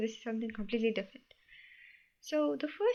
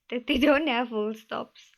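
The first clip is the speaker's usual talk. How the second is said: a laugh breaking into the line, louder; 8 dB louder than usual. Real, not acted. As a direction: chuckle through the words "don't have full stops". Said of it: "to simplify it".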